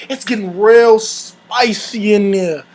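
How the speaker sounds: ordinary delivery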